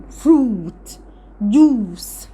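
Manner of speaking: "فروت، جوس" with a held, falling "oo"; long double-O. Shown as a demonstration. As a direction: draw out the vowel in "فروت، جوس". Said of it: "هنطقها كانها اتنين او". (مد الواو في "فروت، جوس")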